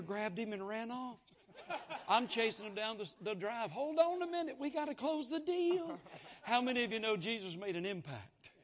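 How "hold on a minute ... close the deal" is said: calling out. Retold or imitated. Chasing man down the drive.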